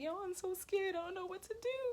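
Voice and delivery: high, frightened plea; scared self asking the voice in my head for help. Retold or imitated. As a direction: pitch it up like you're panicking.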